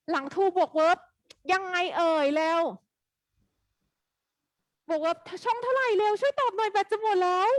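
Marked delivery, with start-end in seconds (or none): 4.9-7.5 high, hurried plea to the class; urgent plea. Real, not acted. Teacher begging the class to answer before the battery dies, urgent and high. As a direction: rush the students for an answer, pitched up.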